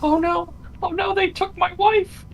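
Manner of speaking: high, mock-panicked wailing; high squeaky mock panic; panicked NPC. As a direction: ham up a helpless villager. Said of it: "this guy who was like".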